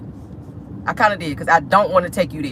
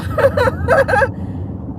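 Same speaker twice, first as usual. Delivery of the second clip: high, helpless laugh; real laughter near tears; laughing hard. Real, not acted. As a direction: crack up till it almost cries. Viewers still teasing her.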